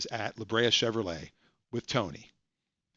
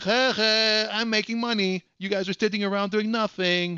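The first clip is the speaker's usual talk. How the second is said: gloating; 8 semitones higher than usual. Retold or imitated. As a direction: rub it in, pitched up. Tony gloats to coworkers.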